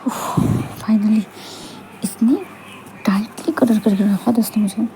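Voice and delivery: whispered aside. Acted.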